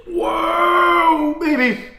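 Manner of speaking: high strained shout at the burn; pained shout. Real, not acted. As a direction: yell out from the burning heat. Reacting to burning heat.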